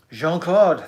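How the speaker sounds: loud, badly faked French accent; terrible French accent. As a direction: call out the name in hammy French. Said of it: "my terrible French accent".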